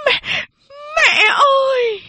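very high, slow wailing cry; crying shout. Acted. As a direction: wail for her mother-in-law. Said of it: "Mẹ tôi bỗng gào lên". (gào khóc thảm thiết, kéo dài)

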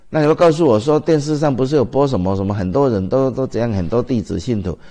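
fervent, loud sermon voice. Real, not acted. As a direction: push back at the doubters.

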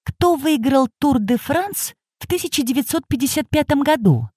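put-on high, soft old woman asking; lightly voiced curious old lady. A narrator's reading.

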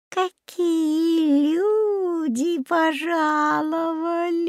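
put-on high, very slow crooning old woman; sugary singsong. A narrator's reading. Old woman greets children at her door, sugary singsong. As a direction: croon a honeyed welcome, stretching the vowels. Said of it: "очень ласковым голосом сказала она, словно пропела".

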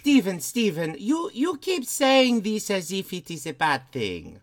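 put-on high, hammy Eastern European accent; Lady Bathory accent. Lady Bathory pitching green living to Stephen, Eastern European accent. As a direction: make an earnest case in a thick put-on Eastern European accent. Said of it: "people said they liked your accents".

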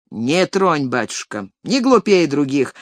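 usual speaking voice

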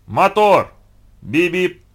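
high, loud set call; loud, punchy director's cue; shouted film command. A narrator's reading. Director's commands over loudspeaker.

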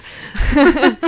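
loud real laugh; big amused laugh; burst of laughter. Real, not acted. Laughing at own joke.

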